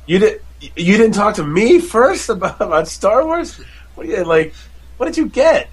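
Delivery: high-pitched retold disbelief at the wife; mock dismay. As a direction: plead in hammed-up incredulity.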